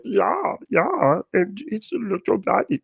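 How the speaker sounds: put-on lightly done high German accent; a hint of a German accent; mock German accent. Comparing Russian and German, a light German accent. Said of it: "one of them's like".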